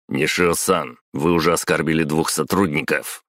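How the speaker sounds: ordinary delivery, nothing marked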